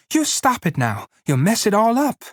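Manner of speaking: she snaps at him to stop, climbing high; woman orders Lenny to stop touching her hair, angry and high; angry cry. A narrator's reading. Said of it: "she cried angrily".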